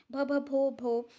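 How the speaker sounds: halting stammer on the name. Acted. Girl stammering to her kind teacher, halting start. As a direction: stumble over the first sound, repeating it. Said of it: "she began to stammer".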